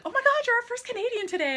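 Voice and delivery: high, fast mock squeal; imitated excited handler. Handler greeting a Canadian fan, imitated high-pitched excitement. Handler's delighted remark, acted out.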